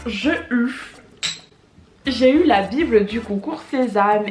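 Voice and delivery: ordinary delivery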